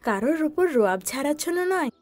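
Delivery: high-pitched mother scolding her son; a mother lecturing, firm and disappointed. Acted. Mother scolding Chinku for misusing his power, high and stern.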